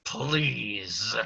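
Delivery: one drawn-out, forced word; Beast, through his teeth. Acted. Beast glaring at Cogsworth, grudging through-the-teeth plea. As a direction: squeeze it through gritted teeth. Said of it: "says through his teeth".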